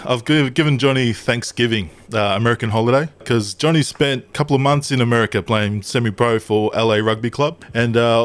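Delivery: normal talking voice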